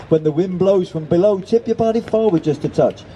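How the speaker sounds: put-on high-pitched merry instruction from an old hand; amused coaching. A narrator's reading. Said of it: "laughed the seagull".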